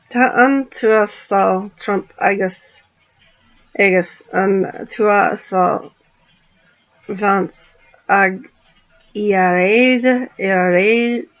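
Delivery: slow, careful sounding-out of Irish words; reading Gaelic syllable by syllable, unsure; halting attempt at Irish Gaelic. Shown as a demonstration. Vlogger reading a message aloud in Irish, slow and halting. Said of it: "I'm gonna try and speak".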